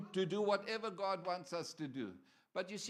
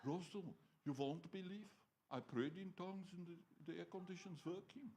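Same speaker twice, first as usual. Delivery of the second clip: put-on German accent; 8 dB quieter than usual. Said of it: "he got German accent".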